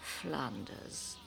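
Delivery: soft, low, defeated; tired and sad. Acted. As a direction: let the fight drain out. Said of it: "I'm very tired".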